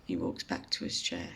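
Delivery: ordinary talk, nothing marked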